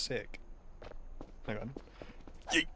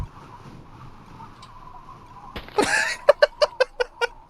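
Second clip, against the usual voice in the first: breathless squealing laughter; high wheezing laugh; 3 dB louder than usual. Real, not acted. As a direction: laugh till no sound comes. Laughing after mistake.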